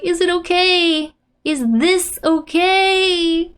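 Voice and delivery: anxious, high acted plea; acted freak-out. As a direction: translate the panic with a long, drawn-out final question. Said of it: "She's full on freaking out".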